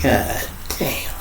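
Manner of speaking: breathy whispered awe; whispered amazement. Retold or imitated. Watching her duck through the door, whispered awe. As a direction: whisper it as she ducks her head.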